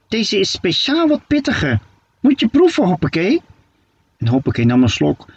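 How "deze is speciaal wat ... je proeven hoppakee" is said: high, sweet-talking witch offering a taste; sly witch voice. Acted. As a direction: coax her into tasting, high and sly. (hoge, sluwe heksenstem)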